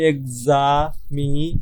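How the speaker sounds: slow, syllable-by-syllable examination, ex-a-mi-na-tion; split into syllables. Shown as a demonstration. Teacher showing students many syllables, slow split. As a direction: spell out each part of examination slowly. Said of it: "তিনটির বেশি syllable থাকে".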